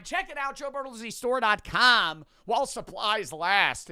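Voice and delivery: high, loud pitch of the store link; store plug. Acted. Host pushes viewers to his store, ad-style plug. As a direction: announce the site like an ad.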